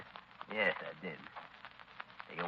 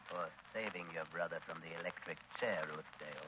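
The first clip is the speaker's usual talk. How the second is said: much softer, low, threatening; quiet menace; 3 semitones lower, 13 dB quieter than usual. Acted. Taggart reminds Ruth of her debt, soft and menacing. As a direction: let the threat sink in softly.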